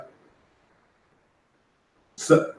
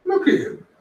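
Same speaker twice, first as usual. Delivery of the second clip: high mocking voice. Mocking the neighbour's hairline.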